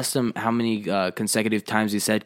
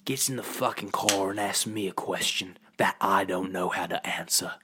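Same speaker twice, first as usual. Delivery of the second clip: put-on London hard man; Jason Statham. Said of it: "Well, as Jason Statham".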